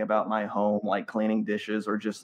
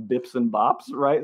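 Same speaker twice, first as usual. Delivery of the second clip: usual speaking voice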